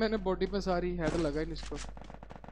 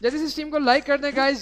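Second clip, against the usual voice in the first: excited plea; 5 semitones higher, 5 dB louder than usual. Real, not acted. Asking for likes.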